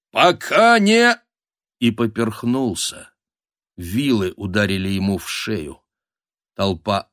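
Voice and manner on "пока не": high, loud shout broken short; shouted, cut off. Acted. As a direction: shout an order until suddenly choked off. Командный крик оборван ударом. (оборванный громкий крик)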